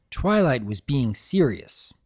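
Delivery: usual speaking voice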